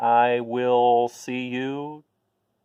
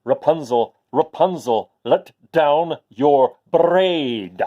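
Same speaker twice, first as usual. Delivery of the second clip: slow, drawn-out louder call; 5 dB louder, slower than usual. Acted. Prince making one last call up the tower, slow and loud. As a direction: cup your hands and call, stretching each word. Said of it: "cupped his hands for one last try".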